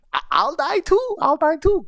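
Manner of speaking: a happy little goblin squeak; squeaky goblin voice. Retold or imitated. Goblin offering to die alongside, high squeaky voice. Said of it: "I used all of the squeaky sounds".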